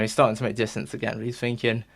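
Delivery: normal talking voice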